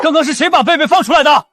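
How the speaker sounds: loud, high-pitched scolding; angry demand. Acted. Young master asking who let Beibei out, angry shout. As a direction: yell at the staff for an answer.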